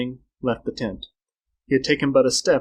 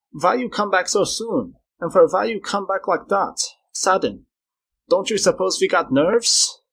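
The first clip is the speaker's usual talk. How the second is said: put-on high, louder German-accented scolding; shrill German accent; 7 semitones higher, 3 dB louder than usual. A narrator's reading. German scolding Esteban for startling him, shrill German accent. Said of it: "shrilled the German".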